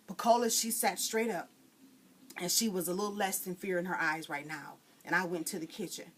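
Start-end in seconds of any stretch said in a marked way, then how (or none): none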